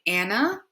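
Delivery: Anna with an English flat A; Anna, English style. Shown as a demonstration. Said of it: "In English, we would say".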